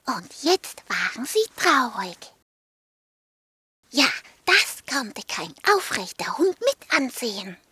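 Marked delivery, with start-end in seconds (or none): none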